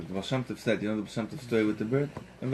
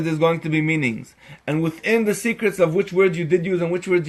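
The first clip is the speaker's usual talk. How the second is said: higher, louder sermon build; forceful preaching; 5 semitones higher, 7 dB louder than usual. Acted. Teacher expounding the Megillah to listeners, fervent preaching. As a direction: preach with rising fervor.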